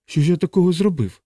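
high-pitched, baffled plea; pleading. Acted. Frightened chaplain pleads his innocence.

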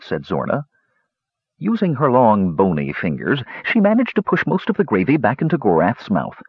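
ordinary delivery

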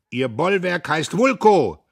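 loud, high, drawn-out taunt; heckler's shout. A narrator's reading. Voice from the office taunts Dietrich, shouting. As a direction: call it across the hall. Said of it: "rief wieder die Stimme vom Büro".

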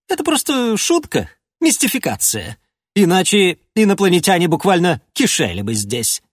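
a mocking, scornful monarch; lightly voiced king, scoffing. King mocks envoy's mission. (насмешливый король)

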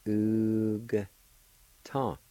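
"Oog", G joined to a long U, pause, then "ta"; syllable by syllable. Shown as a demonstration. Teacher showing the silent-A word for flax, syllable by syllable. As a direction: sound out Oog and ta separately. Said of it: "pronounce the G sound with a U like this".

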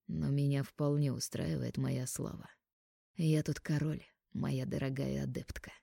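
low, soft menacing threat; quietly threaten, slowing down. Acted.

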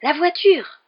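high, loud burst on a sudden idea; excited exclamation. A narrator's reading. Boy revealing his idea to his friend, exclaiming excitedly. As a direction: cry it out, VOITURE leaping up. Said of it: "s'exclama Ron, le regard soudain brillant".

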